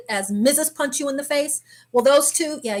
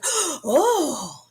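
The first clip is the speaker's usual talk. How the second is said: higher, drawn-out gasp of dismay; shocked crowd reaction; 4 semitones higher than usual. Retold or imitated. Onlookers as the fight starts, high shocked "oh". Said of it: "everybody was like".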